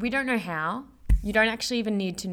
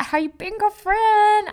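put-on high, loud, playful hey-girl greeting; sassy greeting; 13 semitones higher, 10 dB louder than usual. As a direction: greet your bestie with a big high squeal.

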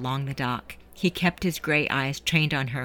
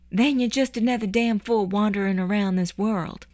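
a lightly voiced young woman, higher and tender; 7 semitones higher than usual. Juliet cupping Eddie's cheek, lightly voiced tender encouragement. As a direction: gently encourage him as a lightly played girl.

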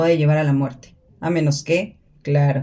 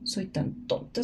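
whispered self-insult; 11 dB quieter, slower than usual. Acted.

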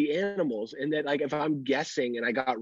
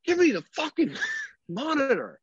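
put-on much higher, bratty demanding baby; demanding toddler voice. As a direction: squeak it out like an impatient toddler. Voicing toddler demanding monitor.

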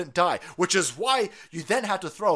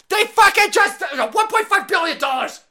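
a real furious shout, much higher and louder; 13 semitones higher, 9 dB louder than usual. Real, not acted. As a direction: yell the number in outrage. Shouting the fine amount.

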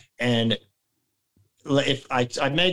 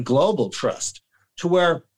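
normal talking voice

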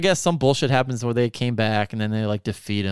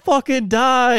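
gleeful cheer; 10 semitones higher, 4 dB louder, slower than usual. Retold or imitated. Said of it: "I'm like".

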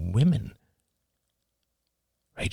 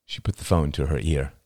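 ordinary delivery, nothing marked

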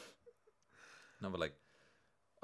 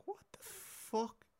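disgusted at himself; 11 semitones higher, 6 dB quieter, much slower than usual. Retold or imitated. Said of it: "And I was just like".

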